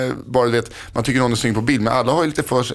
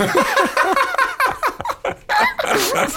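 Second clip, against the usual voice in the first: high laugh. Real, not acted. Laughing at own bad excuse.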